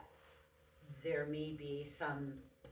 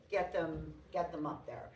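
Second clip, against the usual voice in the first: usual speaking voice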